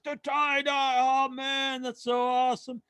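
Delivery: high, starstruck gushing; gushing fan. Retold or imitated. Drunk fan admiring the tie-dye sleeve, high gushing. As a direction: rave like a tipsy fan about the sleeve. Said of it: "He's like".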